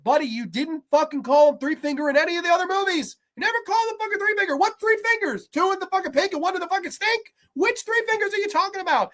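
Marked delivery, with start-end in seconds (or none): 3.4-9.1 incredulous rant. Real, not acted. Ranting at the filmmakers.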